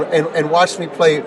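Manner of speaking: ordinary talk, nothing marked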